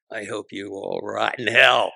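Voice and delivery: slow build to a loud finish; retold angry curse. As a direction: imitate a furious executive, leaning hard on the last words. Vice president cursing the comics.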